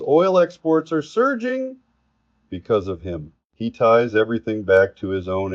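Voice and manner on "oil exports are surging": high-pitched, hyped-up claim; exclaimed boast. Retold or imitated. Commentator quoting a politician's claim about oil, exclaimed high. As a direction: send the voice soaring on a quoted boast.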